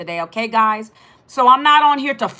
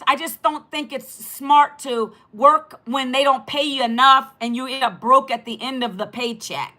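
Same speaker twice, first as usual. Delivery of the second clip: usual speaking voice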